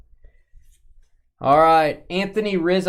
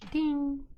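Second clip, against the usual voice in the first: very high, softer "ding"; imitated bell ding; 6 dB quieter than usual. Marking the Texas auto card, imitated bell ding. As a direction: ring out like a little bell.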